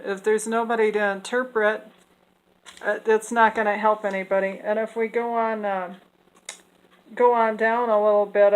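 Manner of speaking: normal talking voice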